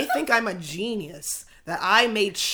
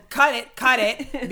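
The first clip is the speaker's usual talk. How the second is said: urgent instruction; 3 semitones higher, 5 dB louder, slower than usual. Retold or imitated. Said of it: "I tell our camera guy to cut it".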